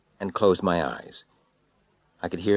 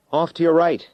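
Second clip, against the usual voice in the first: raised voice over a roaring motor; calling over engine; 11 semitones higher, 7 dB louder than usual. Acted. Arthur directs Richard over the engine, loud call. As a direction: call it out above the noise.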